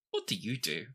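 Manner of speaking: normal talking voice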